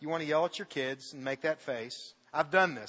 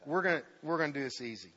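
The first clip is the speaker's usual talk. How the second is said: quick aside; 4 semitones lower, 4 dB quieter, faster than usual. Real, not acted. Aside to volunteer Danny.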